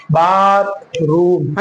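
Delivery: Bathroom with both syllables drawn out; slow model pronunciation. Shown as a demonstration. Teacher models the word for learners, slow and stretched. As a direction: stretch बाथ and रूम evenly for learners.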